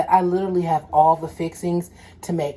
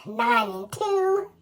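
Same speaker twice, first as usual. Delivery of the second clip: awkward dismissal; 6 semitones higher, 4 dB quieter than usual. Retold or imitated. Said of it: "we was like".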